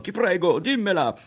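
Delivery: high, loud pleading cry; urgent begging. A narrator's reading. Ario jumps around Berto, loudly begging to hear it. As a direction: hop around him, begging. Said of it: "supplicò".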